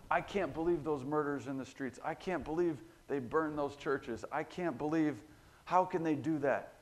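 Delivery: indignant finger-pointing. Acted. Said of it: "You've heard that spirit before".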